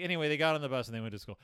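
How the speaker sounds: rattled off fast, summing it up; quick wrap-up narration; brisk dismissive narrator. Retold or imitated. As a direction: rush through it like a throwaway story ending. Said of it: "the narrative is just like".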